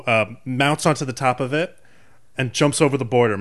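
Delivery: ordinary talk, nothing marked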